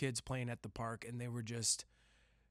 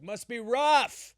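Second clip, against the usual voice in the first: high, loud, slow yell; screamed; 11 semitones higher, 11 dB louder, slower than usual. Retold or imitated. At the gas station, yelling at the kid with a high, loud scream. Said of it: "That's what I screamed at him".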